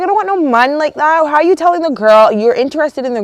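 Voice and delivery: high-pitched, scolding comeback; acted-out indignation, pitched well up; indignant retort. Acting out her indignant retort.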